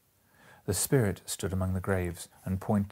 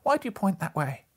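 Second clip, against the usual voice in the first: much higher, urgent question; anxious question; 12 semitones higher, 4 dB louder than usual. A narrator's reading. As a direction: demand an answer, pitched up. Scrooge pleads with the ghost.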